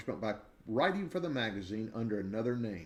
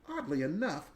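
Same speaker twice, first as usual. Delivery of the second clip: usual speaking voice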